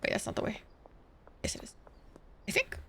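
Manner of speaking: soft whisper to self; hushed muttering; whispered doubt. Real, not acted. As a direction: murmur it uncertainly.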